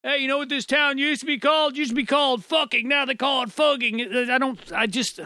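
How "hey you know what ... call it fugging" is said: raised, chattering mock voice; joking onlookers. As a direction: imitate a joker passing on gossip, pitched up. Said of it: "They're gonna be like".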